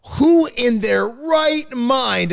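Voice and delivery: incredulous question, drawing out "right mind"; emphatic stress. Real, not acted. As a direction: lean hard and slowly on "right mind". Emphatic rhetorical question, names key word.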